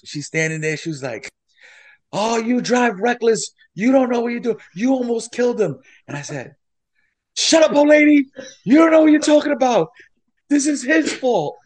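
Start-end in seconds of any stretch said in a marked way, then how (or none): 2.1-5.8 put-on high-pitched old lady telling-off; scolding old lady. Old lady bystander scolds reckless driver, high scolding. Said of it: "this old lady's". 7.4-11.6 high, loud retold yell; angry comeback. Driver to meddling old lady, angry yelling.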